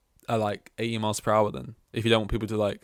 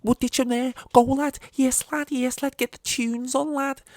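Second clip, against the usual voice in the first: much higher, louder Scouse lads egging each other on; Liverpool accent; 7 dB louder than usual. Retold or imitated. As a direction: shout it like hyped Liverpool kids in a getaway car. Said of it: "just the accents".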